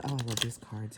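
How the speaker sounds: ordinary talk, nothing marked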